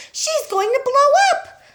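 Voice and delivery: high, loud, slow wail; panicked wail. A narrator's reading. Missus Cranky wailing at her steaming mother, high and loud. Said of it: "Missus Cranky wailed".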